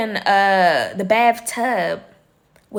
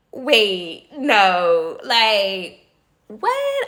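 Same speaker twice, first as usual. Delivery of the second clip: disbelief; much slower than usual. Retold or imitated. Disbelief at the dirty bathroom.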